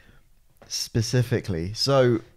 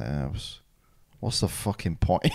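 gloomy driver; 7 semitones lower, 5 dB quieter than usual. Retold or imitated. Driver voiced despairing again.

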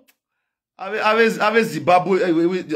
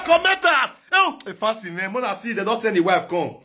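ordinary talk

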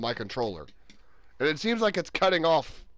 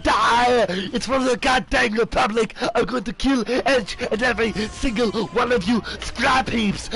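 higher, hammed-up war rant; put-on battle voice; 4 semitones higher, 3 dB louder than usual. Gamer threatening the clankers, hammed-up raised voice. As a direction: rant like a vengeful trooper. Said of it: "Sorry for doing the voices".

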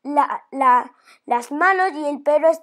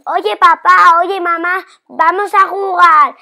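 loud call to mum and dad; child loudly calling her parents to come play; 3 semitones higher, 8 dB louder than usual. Acted. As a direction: call out to get their attention.

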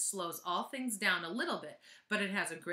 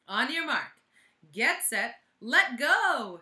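loud countdown getting louder to the end; calling out; 5 semitones higher, 10 dB louder, slower than usual. Acted. Teacher calling the drop to kids at the windows, loud and building. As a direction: call the start like a race official, building.

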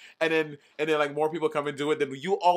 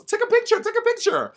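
high, loud nagging aunt; pushy aunt; 4 dB louder than usual. Retold or imitated. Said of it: "she always goes".

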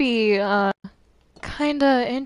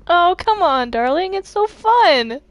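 higher, drawn-out wheedling; playful coaxing; 6 semitones higher than usual. Acted. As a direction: coax them slowly into the fun. Coaxing the unwilling player to join.

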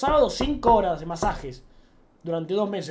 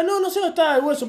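playing dumb; 7 semitones higher, 3 dB louder than usual. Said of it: "me hacía un poco el pobrecito".

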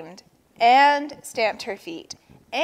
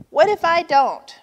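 high, loud defiant shout; 8 semitones higher, 9 dB louder than usual. A narrator's reading. Vulture defying Turtle's demand, shouting. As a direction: shout it back as a challenge. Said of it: "shouted Vulture".

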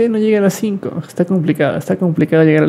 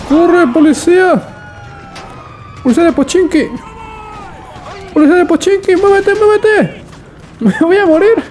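very high frantic shouts; hysterical shouting; 10 semitones higher than usual. Real, not acted. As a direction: yell in wild panic. Screaming at police, dying.

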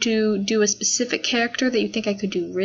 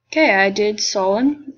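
usual speaking voice

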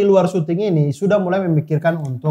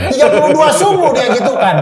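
laughing; 6 semitones higher, 4 dB louder than usual. Real, not acted. Laughing retelling of stove story.

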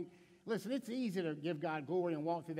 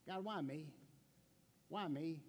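quiet lament; 3 semitones lower, 6 dB quieter than usual. Retold or imitated. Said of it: "You ever ask that".

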